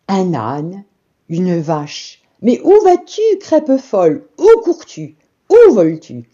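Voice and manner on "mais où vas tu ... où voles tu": high, loud calling after the pancake; animals calling out. A narrator's reading. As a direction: shout after her as she rolls off. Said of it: "cria le cochon".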